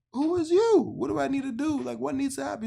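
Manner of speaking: shocked man asking a stranger what he wants; baffled outburst. Retold or imitated. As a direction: blurt it out, totally thrown. Baffled reaction to stranger's offer.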